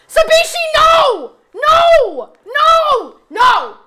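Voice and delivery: high, loud frantic shout; all-out shout of protest. Real, not acted. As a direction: yell it in panic. Streamer shouts at a timeout.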